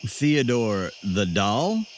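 normal talking voice

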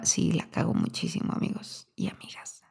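hushed confiding whisper; whispered aside. Real, not acted. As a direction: drop to a whisper.

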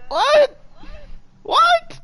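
very high, loud ecstatic yelp; excited what. Real, not acted. Host yelps in delight after singing the hook, very high. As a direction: shriek it out in delight.

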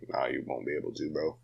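low, quiet, flat reply; deadpan dismissal, half under breath. Retold or imitated. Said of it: "I'm like".

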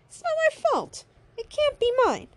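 very high, squealed protest; squeaky, defensive mock outrage; protesting denial. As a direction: deny it in a high-pitched whine. Acted denial in an argument.

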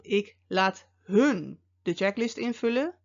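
"hun" drawn out and set apart by a pause; stress on HUN. Shown as a demonstration. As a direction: punch the word "hun", then pause briefly. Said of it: "met nadruk op".

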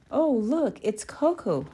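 high, loud taunting call; mocking. A narrator's reading. Bully spots her target after school, mocking. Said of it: "mocked Sam".

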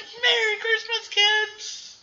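very high, exuberant call; overjoyed greeting. Retold or imitated. George to his kids on the stairs, a very high, overjoyed greeting. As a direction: greet them with giddy delight. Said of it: "He's like".

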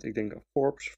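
ordinary delivery

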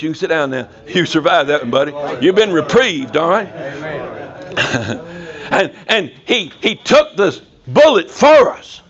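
ordinary talk

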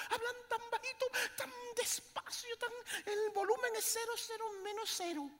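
high, laughing through the words. Real, not acted. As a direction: giggle while describing whispering lovers. Laughing mockery of lovers.

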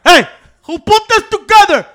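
very loud movie-quote bellow, pitched high; ear-splitting shout. Acted. Host startles his co-host with a shouted quote, ear-splitting. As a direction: yell it full force into the mic. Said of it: "people's ears hurt, Pancho".

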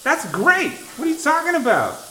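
high, loud disbelief; incredulous outburst. Real, not acted. Friend seeing the sink's water pressure, incredulous high outburst. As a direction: protest loudly, voice shooting up. Disputes host's claim on seeing sink.

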